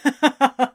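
loud burst of disbelieving laughter; continued laughing. A narrator's reading. Friend still laughing at the announcement. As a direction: laugh right over his protest. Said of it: "Gabriel continued laughing".

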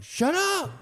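high-pitched mock yell of a fed-up neighbor; imitated snapping. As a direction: imitate a dad snapping at his screaming kid. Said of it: "comes off like he just snapped".

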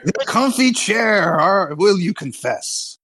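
high, slow, theatrical interrogator; Monty Python inquisitor. Retold or imitated. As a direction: draw it out like a hammy inquisitor. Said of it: "Spanish Inquisition from Monty Python".

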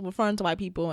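ordinary talk

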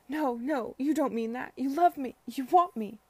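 high, loud, pleading denial; desperate insistence. A narrator's reading. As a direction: refuse to accept it, pushing each phrase harder. Rejected drunk lover insists desperately.

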